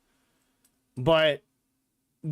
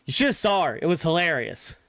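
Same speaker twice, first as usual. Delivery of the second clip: ordinary delivery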